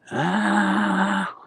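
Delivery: high, drawn-out mock scream; imitated scream. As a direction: imitate a sleeper's long high wail. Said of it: "So he stopped screaming".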